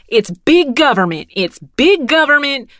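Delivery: imitating a stranger yelling about government; mock yelling rant. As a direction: ham up a ranting woman. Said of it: "please stop yelling at me".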